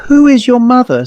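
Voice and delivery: high, loud call after her. Acted. Woman calling after the child vanishing up the stairs, high loud call. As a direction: shout it after the fleeing child.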